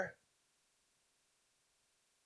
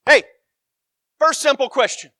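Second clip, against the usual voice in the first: insistent, louder; 8 semitones higher, 8 dB louder than usual. Acted. Preacher pressing the question harder, louder. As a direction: hit FIRST harder and higher.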